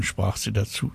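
ordinary delivery

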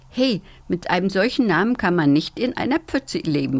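plain reading voice

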